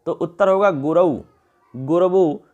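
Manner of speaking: ordinary talk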